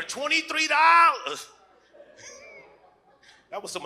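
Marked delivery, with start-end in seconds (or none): 0.0-1.5 twenty-three dollars said much higher and proud; pitched-up proud repeat; higher emphatic repeat. Acted. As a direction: lift the line up high to sell it.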